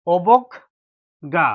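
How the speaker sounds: অবজ্ঞা stretched very slowly; slow model word. Shown as a demonstration. Teacher explains the ending sound, অবজ্ঞা drawn out slowly. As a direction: draw out অবজ্ঞা for the class. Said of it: "যদি শব্দের শেষে আছে".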